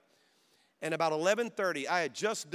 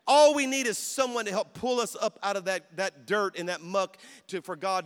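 preaching at full force; 2 semitones higher, 6 dB louder than usual. Real, not acted. Pastor preaching to young people, building into a loud cry. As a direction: lift the crowd, preach it hard.

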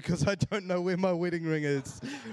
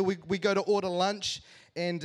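ordinary talk, nothing marked

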